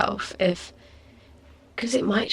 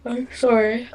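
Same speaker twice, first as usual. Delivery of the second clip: yawning; 5 semitones higher, 3 dB louder than usual. Real, not acted. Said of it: "I'm yawning".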